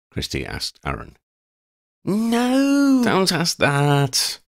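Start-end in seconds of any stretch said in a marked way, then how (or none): 2.1-4.4 high, loud drawn-out warning; alarmed high shout of warning. A narrator's reading. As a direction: cry out to stop the question, stretching the words. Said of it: "Oddie and Freddie warned".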